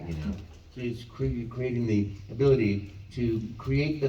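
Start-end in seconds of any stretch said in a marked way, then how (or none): none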